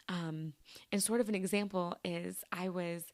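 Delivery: ordinary talk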